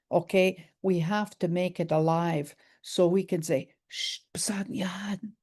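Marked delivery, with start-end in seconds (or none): 3.9-5.3 hushed, slow whisper; soft unvoiced hush. Shown as a demonstration. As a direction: breathe it out softly, no voice. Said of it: "You say it quietly".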